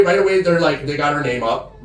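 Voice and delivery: normal talking voice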